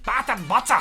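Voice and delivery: loud Scottish accent, relishing the words; Scottish-accented mock shout. Shown as a demonstration. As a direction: bellow it in broad Scottish disgust. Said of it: "two horrible words in a Scottish accent".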